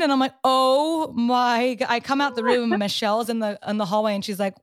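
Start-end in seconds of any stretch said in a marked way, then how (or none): 0.4-1.9 drawn-out horrified gasp; slow dawning shock. Retold or imitated. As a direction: stretch it out in dismay. Realizing the mistake.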